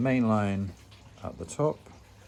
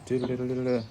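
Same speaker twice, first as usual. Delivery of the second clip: playful doodle noise; 7 semitones higher, 3 dB louder, faster than usual. Pretend. Mimics weight bobbing underwater.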